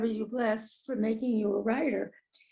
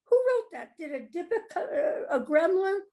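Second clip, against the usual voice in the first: amazed wondering; 7 semitones higher than usual. Retold or imitated. Writer amazed at own work.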